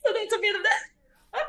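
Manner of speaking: laughing. Real, not acted. Laughs after Singlish demo.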